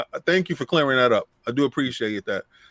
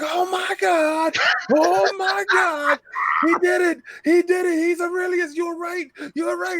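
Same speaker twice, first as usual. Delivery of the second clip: imitating Boom's excitement. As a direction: squeal it like a friend losing his mind over the news. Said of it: "Boom is like".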